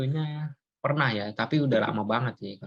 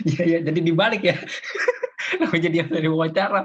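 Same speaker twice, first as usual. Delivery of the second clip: higher, louder, through real laughter; laughing while talking; 5 semitones higher, 5 dB louder than usual. Real, not acted. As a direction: talk through a burst of laughter. Amused that interview roles got reversed. (sambil tertawa geli)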